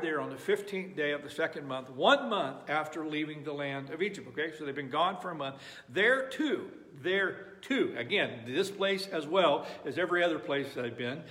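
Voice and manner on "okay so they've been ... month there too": ordinary talk, nothing marked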